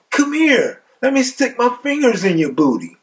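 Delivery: very high-pitched mocking call; jeering. Cellmate jeers at Lyle downstairs, high mocking call. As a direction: beckon him in a high, teasing voice.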